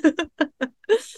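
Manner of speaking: bright, loud burst of laughter; high laugh. Real, not acted. Guest on the podcast laughing at her own story. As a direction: let a high laugh spill out.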